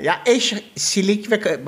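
normal talking voice

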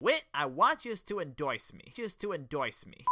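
slow, hammed-up Bart accent; exaggerated accent, pleading as Bart; Bart's accent. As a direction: stretch the words in Bart's accent. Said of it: "That's a great accent right there".

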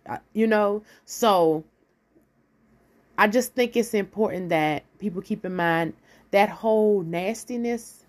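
usual speaking voice

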